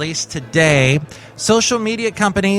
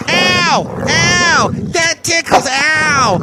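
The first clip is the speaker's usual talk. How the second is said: much higher yelps, tickled and squealing; Bison Bentley yelping; 11 semitones higher than usual. Acted. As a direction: squeal from being tickled by the dog. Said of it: "Basil doesn't take too kindly".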